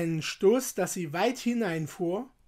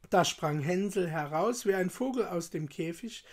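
ordinary delivery

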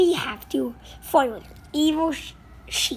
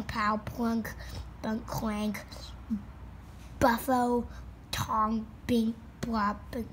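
low, soft spoken sound effects; deadpan sound effects; 7 semitones lower, 6 dB quieter, slower than usual. Acted. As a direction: read each crash noise dryly. Voicing crash sound effects.

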